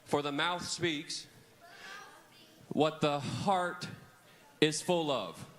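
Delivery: slow, each phrase set apart with pauses; measured memory-verse chant; slow phrase-by-phrase recitation. Shown as a demonstration. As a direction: model the verse slowly for kids to echo. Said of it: "everyone point at your mouth".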